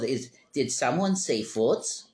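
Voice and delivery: slow, put-on little cat voice; playful pet voice asking for feet; cat's voice. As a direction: voice the cat, drawn out and silly. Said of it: "and he goes".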